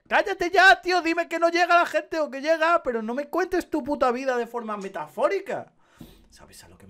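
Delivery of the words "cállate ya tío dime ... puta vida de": much higher, loud exasperated yelling; angry shout. Real, not acted. Gamer yelling at a pretentious NPC, very high and loud. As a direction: tell the wordy NPC to shut up.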